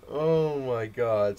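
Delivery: slow, loud, drawn-out oh my god; stunned disbelief. Real, not acted. Telling viewers about the comic's price, slow stunned exclamation. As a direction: stretch it out, still reeling.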